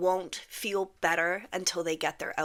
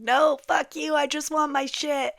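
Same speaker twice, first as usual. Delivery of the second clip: raised, high frustrated mock yell; loud, impatient acted-out refusal; yelling at screen; 8 semitones higher, 5 dB louder than usual. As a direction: imitate a viewer yelling back in protest. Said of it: "yelling at the screen saying".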